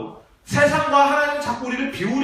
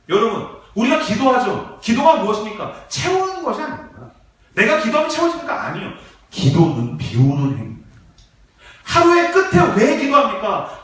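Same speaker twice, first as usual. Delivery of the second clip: usual speaking voice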